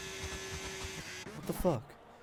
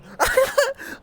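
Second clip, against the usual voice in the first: loud, high burst of laughing; high cackling laugh; laughter; 5 dB louder than usual. Real, not acted. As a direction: crack up suddenly at the chaos. Laughs at race incident.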